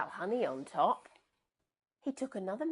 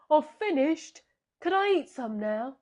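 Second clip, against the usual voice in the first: eager hungry bear; 8 semitones higher, 9 dB louder than usual. Acted.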